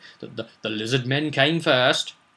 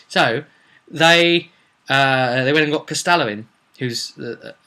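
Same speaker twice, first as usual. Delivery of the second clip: ordinary delivery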